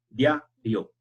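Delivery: diario, the i gliding into the a, dya-rio; diario demonstrated. Shown as a demonstration. Teacher models diario as the example for the class. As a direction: give diario as a clear model word. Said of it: "eso es justamente lo que pasa aquí".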